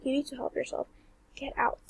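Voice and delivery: whispered get out to the stranger at the window; tense whisper. Retold or imitated. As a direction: hiss it under your breath. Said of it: "And I'm like".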